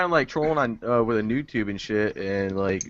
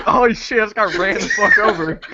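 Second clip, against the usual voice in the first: high, louder stunned cry; retold shock; 8 semitones higher, 4 dB louder than usual. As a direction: blurt it like you just got flattened. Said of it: "I was like".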